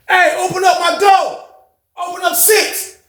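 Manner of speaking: high, slow shout to open the cell door; shout to the guard. Retold or imitated. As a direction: call out to the officer, drawn out.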